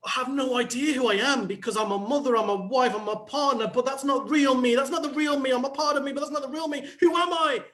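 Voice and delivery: high, frantic acted meltdown; hammed-up identity crisis, pitch rising to the end; nervous breakdown. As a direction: spiral into panic, climbing to a cry. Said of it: "nervous breakdown at some point going".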